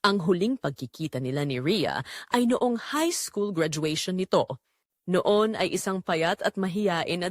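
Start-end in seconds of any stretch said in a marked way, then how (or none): none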